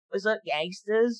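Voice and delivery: slow, high creepy voice; weird creepy voice. Retold or imitated. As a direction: say it like a creepy nerd. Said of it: "this weird, creepy voice".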